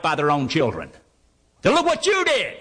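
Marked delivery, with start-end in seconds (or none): 1.6-2.5 put-on child blaming parent.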